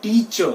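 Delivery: टीचर as tee-chuh, silent r; correct pronunciation. Shown as a demonstration. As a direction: drop the final r in टीचर. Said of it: "उसको हम क्या बोलेंगे?". (टीचर का सही उच्चारण, बिना र के)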